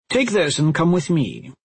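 lightly voiced commanding witch; demanding. A narrator's reading. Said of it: "Merrin demanded".

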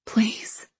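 pleading whisper; begging, barely voiced. A narrator's reading.

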